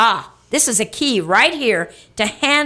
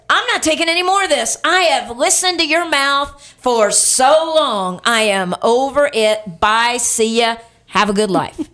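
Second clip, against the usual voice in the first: fed up; 2 semitones higher than usual. Acted. Somebody fed up with a nagging partner, exasperated. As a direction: act out someone walking out, fed up. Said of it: "Somebody says".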